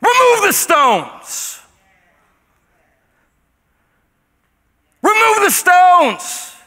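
high, slow, booming command; commanding shout. Acted. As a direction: roar the order across the tomb. Jesus commanding at the tomb.

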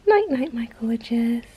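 real tender whisper; goodnight whisper. Real, not acted. Mum whispering goodnight to her baby.

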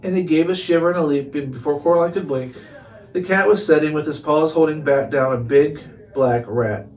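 ordinary delivery

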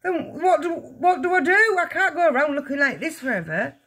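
high, stammering, frantic voice; panicked. A narrator's reading. Father cannot go out like this, panicked. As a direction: stumble over it in rising desperation.

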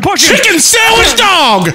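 high, loud, slow yelled nonsense; mock outburst. Friend yells random nonsense at the lobby. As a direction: screech it out, stretched and high.

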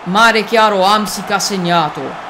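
high, loud goal call; breathless italian goal commentary. Acted. Goal call during match.

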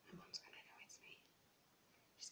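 hushed aside; whisper. Real, not acted. A prankster on a call, whispering aside. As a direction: whisper it to yourself. Whispering before calling mum.

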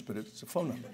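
deadpan aside. Shown as a demonstration. Speaker jokes that nobody answers the card's line, deadpan and soft. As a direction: mutter it under your breath as a dry joke. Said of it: "No one answers".

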